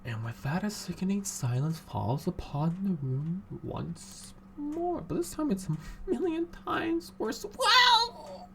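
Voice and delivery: quiet story narration after a fight; hushed narration. A narrator's reading. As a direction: read the aftermath in a near hush. Player reads grim narration quietly.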